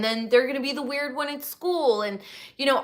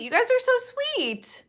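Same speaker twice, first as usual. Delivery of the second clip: squeaky, much higher thank-you; sweet high voice; 13 semitones higher than usual. Real, not acted. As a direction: gush at them in a tiny voice. Thanking chat in a cute voice.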